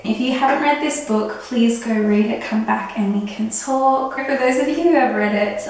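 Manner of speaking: usual speaking voice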